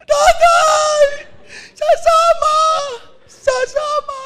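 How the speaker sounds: high shrieking cry; mock wailing sister. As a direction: wail it hammed-up at the coffin. Sister wailing at father's coffin. (pabirong palahaw ng kapatid)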